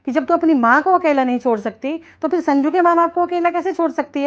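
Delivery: loud, high, impassioned lecture; stern scolding. Acted. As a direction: press the point home forcefully. Mother scolds daughter about in-laws.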